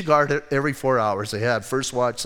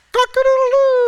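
rooster crow in a much higher, louder voice; 7 dB louder than usual. Retold or imitated. Preacher acting out the rooster over Peter's swearing, loud high crow. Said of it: "a rooster crowed".

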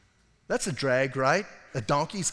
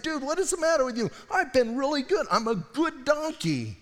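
higher, fast pleading donkey; 10 semitones higher than usual. Retold or imitated. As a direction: whine it like a wronged donkey. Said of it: "this donkey's just, like, saying".

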